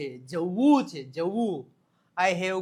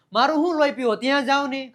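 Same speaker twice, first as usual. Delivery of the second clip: mock irritation; 6 semitones higher, 7 dB louder, faster than usual. Acted irritated sending someone away.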